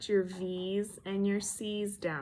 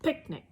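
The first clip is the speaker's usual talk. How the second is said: "Picnic" blended, pick stronger than nick, pitch high; stress on first syllable; 7 semitones higher than usual. Shown as a demonstration. Teacher blending picnic with first-syllable stress. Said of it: "we say pick a little stronger than we say nick".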